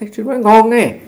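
imitating the driver's delighted amazement; excited praise. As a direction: gush over his English like a delighted fan. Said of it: "Tôi nói".

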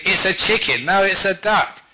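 loud, high back-and-forth contradiction; mock squabble, emphatic and insistent; quarrelling. Re-enacts the couple's quarrel.

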